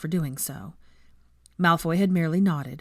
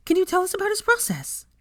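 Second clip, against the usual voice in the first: high, bright eager student voice; a keen young pupil introducing herself; higher, keen young voice; eager schoolgirl; 14 semitones higher than usual. A narrator's reading. Student presses on.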